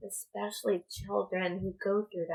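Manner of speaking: hushed, choked-up words, slowed and fading to a whisper; holding back tears. Real, not acted. Mother on her video, close to real tears. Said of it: "I didn't want to cry".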